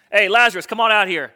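loud, playful holler to someone; casual calling out. Pretend. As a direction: call him out like it's nothing. Imagined Jesus calls Lazarus out.

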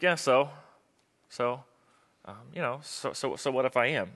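quiet, shrugging indifference; indifferent. Acted. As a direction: play not caring, softly. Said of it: "you just don't care".

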